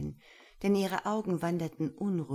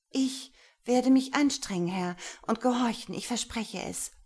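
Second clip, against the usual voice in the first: much higher, louder urgent voice; breathless plea; 6 semitones higher, 4 dB louder than usual. Acted. Lena begs Gero for strict training, high and urgent. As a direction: blurt it out wide-eyed.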